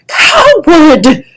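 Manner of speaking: loud, high furious bellow. Acted. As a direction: hurl the insult at him. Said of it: "the older dragon bellowed".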